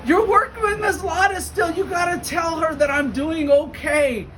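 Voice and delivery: high, loud imitation of a pushy teen; teen insisting the principal pass on news, shouting high; eager shout. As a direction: call it out like it can't wait. Attendant urgently insists.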